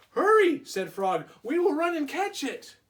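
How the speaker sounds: very high, loud urgent Frog. Acted. Frog urging Toad to chase the list, high and loud. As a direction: push Frog to hurry.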